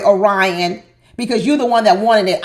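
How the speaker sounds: ordinary talk, nothing marked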